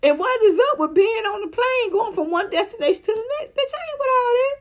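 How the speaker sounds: high scoffing rant; incredulous rant. Real, not acted. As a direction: squeal your disbelief. Ranting about travel posts.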